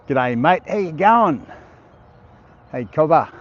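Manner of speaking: broad, put-on Australian greeting, pitch leaping up; full-on Australian accent. Shown as a demonstration. Streamer proving to viewers he can do it, exaggerated Australian accent. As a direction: ham up the Australian accent like a mate calling out. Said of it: "I can put it on".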